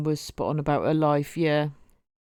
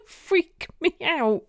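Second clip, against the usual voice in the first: high giggle through the words; laughing; 12 semitones higher, 3 dB louder, slower than usual. Real, not acted. Imagining the door opening on nobody, laughing high. Laughs at own spooky idea.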